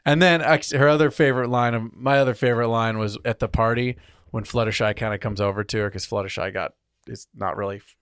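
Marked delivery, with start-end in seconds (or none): none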